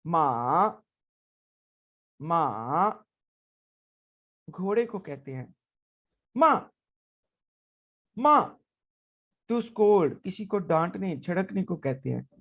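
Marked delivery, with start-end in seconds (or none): 0.1-3.0 माँ dipping down then rising; third tone. Shown as a demonstration. Teacher demonstrating the dipping third tone on माँ. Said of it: "तो देखते हैं कैसे अदा करना है". 6.4-8.6 माँ starting high and dropping sharply; fourth tone. Shown as a demonstration. Teacher demonstrating the sharp falling fourth tone on माँ. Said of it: "तो देखते हैं कैसे अदा करना है".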